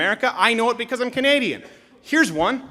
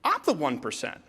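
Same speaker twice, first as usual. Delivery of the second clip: quoted workers; 6 semitones lower, 8 dB quieter than usual. Retold or imitated.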